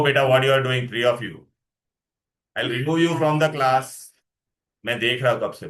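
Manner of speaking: normal talking voice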